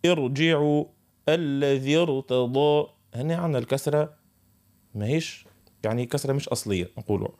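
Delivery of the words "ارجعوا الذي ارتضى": slow recited ارجعوا and ارتضى with a heavy R; Quran recitation. Shown as a demonstration. Teacher recites examples of the heavy R, slow. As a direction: intone the example words slowly. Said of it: "مثل".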